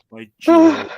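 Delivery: gasping, high and loud; shocked gasp. Real, not acted. Gasp at escaping cat.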